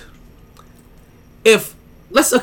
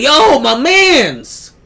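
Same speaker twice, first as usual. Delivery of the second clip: laid-back high reassurance; 8 semitones higher, 5 dB louder, much slower than usual. Retold or imitated.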